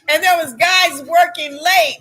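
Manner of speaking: ordinary talk, nothing marked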